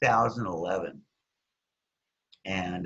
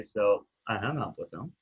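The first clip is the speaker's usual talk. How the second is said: normal talking voice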